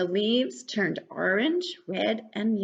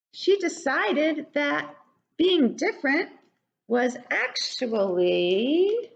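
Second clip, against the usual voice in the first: lively, high questioning storytime voice; reading to children; 5 semitones higher than usual. Acted. Reading to children, asking them questions, high and lively. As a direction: draw the children in.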